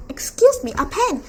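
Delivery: high, loud mock indignation; sarcastic outrage. Percy sassing the Latin teacher, high mock outrage. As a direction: squeak it out in disbelief.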